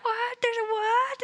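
surprised. Retold or imitated. Party reacts in retold scene.

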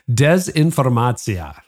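put-on slow, drawn-out Russian accent on dezinformacja. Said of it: "I tried my best Russian voice".

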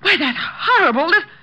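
high outraged exclamation; indignant outrage. Acted. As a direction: react in scandalised horror. Learns the man was armed.

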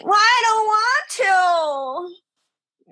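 put-on high, slow, drawn-out toddler whine; whiny child. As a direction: whine it out like a sulky little girl. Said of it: "She whine cried".

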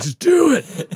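high strained plea through pain; desperate plea. Retold or imitated. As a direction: beg him to just get it over with. Telling dentist to go on despite pain.

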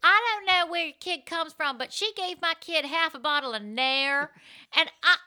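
lightly voiced Southern mom complaining; Southern accent. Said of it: "Southern accent".

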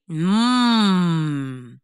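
low, smug hum. A narrator's reading. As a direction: hum it like a cheeky deer.

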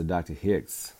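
normal talking voice

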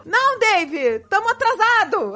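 fingindo pressa, voz aguda; disfarce encenado. Retold or imitated. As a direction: reencena um disfarce mal feito, tom alto demais. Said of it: "Aí eu comecei a disfarçar". (disfarçando sem jeito)

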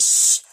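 sharp voiceless shush; imitating a friend's urgent shush; retold shush. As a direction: hush someone fast and hard. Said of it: "And she's like".

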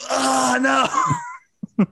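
mock anguished cry. Mock horror at the accent.

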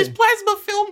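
hammed-up falsetto; silly falsetto. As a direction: squeal it like a cartoon guy. Mimicking character falsetto voices.

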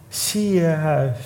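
slow, thin, barely there "Ziekenhuis"; a faint voice forced out through a sore throat; thin weak voice. Retold or imitated. Stroke patient answers the nurse, thin and slow. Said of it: "met een hele dunne stem".

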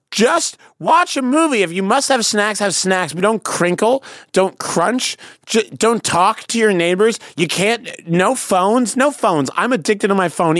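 exasperated, high-pitched rant; emphatic pleading tirade; ranty. Real, not acted. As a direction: lay down the rules, fed up and worked up. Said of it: "yours was so ranty".

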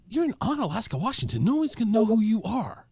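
high-pitched, disbelieving inner voice; incredulous retort. Retold or imitated. His exasperated inner thought, retold.

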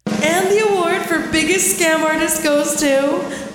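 slow grand announcer voice; award show announcer. Pretend. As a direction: announce the winner with fanfare.

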